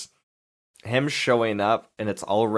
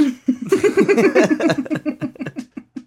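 long high-pitched laughing fit; laughter; 5 dB louder than usual. Real, not acted. Host cracking up after voicing the movie kid, laughing. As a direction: crack up loudly at your own impression.

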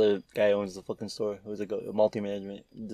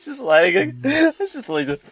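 high, laughing through the words; 10 dB louder, slower than usual. Real, not acted. Watching the footage of himself, laughing hard and high. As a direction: gasp it out mid-laugh.

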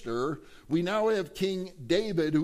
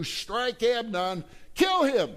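higher, louder, slow order; commanding Absalom; 6 semitones higher, 3 dB louder, slower than usual. Acted. Absalom ordering his servants to kill Amnon, slow and loud. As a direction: hand down the death order deliberately.

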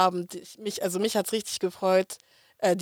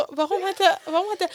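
retold alarm; 10 semitones higher than usual. Retelling the group's alarm, amid laughter.

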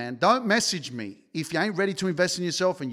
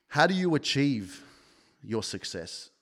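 ordinary delivery

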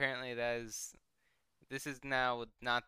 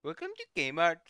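mock Indian accent; 6 semitones higher, 6 dB louder than usual.

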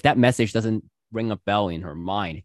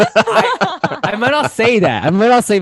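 laughing; 10 semitones higher, 9 dB louder, slower than usual. Real, not acted. Laughing while objecting.

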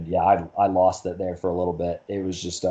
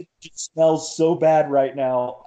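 high, a little louder, half-whined complaint; mock teary complaint; 7 semitones higher, 4 dB louder, slower than usual. Runner retelling his teary whine to his buddy's wife, high and a bit loud. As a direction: play it as a teary runner griping about the trash. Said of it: "And I was like".

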